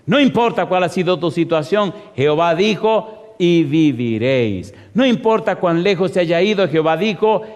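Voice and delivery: loud, drawn-out scripture proclamation; preaching at full force. Real, not acted. Preacher declares God's promise to congregation, proclaiming loudly. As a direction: proclaim it with rising, insistent force.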